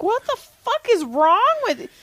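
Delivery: high, loud, put-on shocked voice; shocked disbelief. Reacting to violent videos in her feed, high shocked voice. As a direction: recoil at the screen in disbelief. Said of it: "and I'm like".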